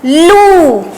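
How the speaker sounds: लू with a long, held "oo"; syllable demo. Shown as a demonstration. Teacher adding the vowel sign to ल, लू drawn out. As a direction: stretch the vowel of लू. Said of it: "ल में लगेगी तो".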